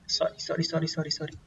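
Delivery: soft, hurried whispered apology; a real whispered apology off-mic; whispered sorry. Real, not acted. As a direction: whisper sorry under the breath, aside. Quick apology off to the side.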